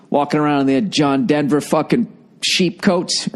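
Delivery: ordinary talk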